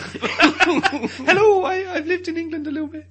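high, laughing mock Irish voice; squeaky put-on Irish accent. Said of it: "She doesn't speak like that".

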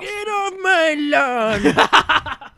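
put-on loud, high, drawn-out old man holler; hammed-up old codger shouting at trespassers; old guy voice. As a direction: yell it slowly like a cranky old guy. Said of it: "I can do the old guy voice".

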